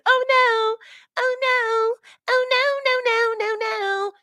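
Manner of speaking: high, slow wailing oh no; mock panic. Host imitating a funny video clip for radio listeners. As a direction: wail it like a meme video. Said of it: "If all they hear is".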